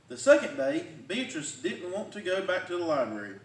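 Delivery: plain reading voice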